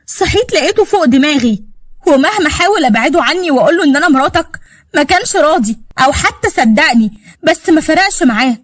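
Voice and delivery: high-pitched aggrieved protest; hurt indignation. Acted. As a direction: defend yourself hotly against an unfair accusation. Wronged wife defends herself, insults husband.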